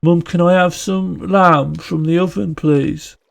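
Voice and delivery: high, drawn-out Brummie plea to mum; Brummie accent. Pretend. Child asking mum for food, high Brummie accent. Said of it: "doing a Brummie accent asking for".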